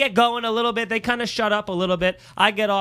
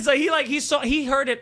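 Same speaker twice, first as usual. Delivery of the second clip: usual speaking voice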